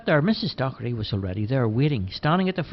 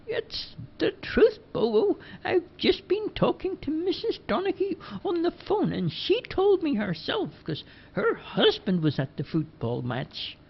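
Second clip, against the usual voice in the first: sweet and unhurried, pitched up; Missus Dockery. Acted.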